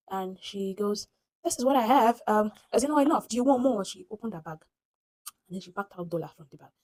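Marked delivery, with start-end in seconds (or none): 1.4-3.8 louder, higher imitated passenger; insistent retold reply. Passenger offers driver more money, loud and insistent. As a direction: push the offer out loudly.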